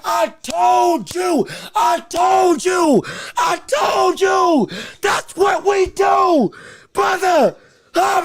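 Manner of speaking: slow, high, loud gloating; triumphant shouting. Real, not acted. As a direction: drag it out, crowing in victory. Gloating after predicted knockdown.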